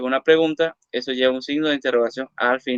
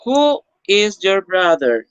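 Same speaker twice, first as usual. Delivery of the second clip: slower, higher and louder, each word clear; slow model question; 5 semitones higher, 4 dB louder, slower than usual. Shown as a demonstration. Teacher modelling a question for the class, slow and clear. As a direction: read it out slowly for the class to copy. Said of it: "teacher models, then repeats plainly".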